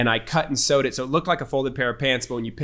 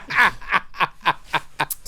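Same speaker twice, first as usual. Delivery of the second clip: breathy laugh; 5 semitones higher than usual. Real, not acted. Laughs at his own grand title.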